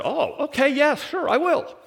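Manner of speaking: high, quick happy yes; eager agreement. Retold or imitated. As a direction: agree without a second thought. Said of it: "and Rebecca said".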